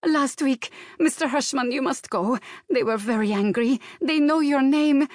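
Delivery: put-on high, insistent Slavic-accented warning; pleading Slavic accent. A narrator's reading. Maid warning employer they know him, pleading Slavic accent. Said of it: "her accent growing stronger".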